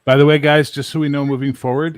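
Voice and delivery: usual speaking voice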